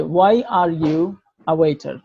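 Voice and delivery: Why are you a waiter drawn out word by word; slow teacher model; slow model question. Shown as a demonstration. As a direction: say the question very slowly. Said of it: "আমি যদি বলি যে".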